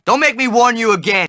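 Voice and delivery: ordinary talk, nothing marked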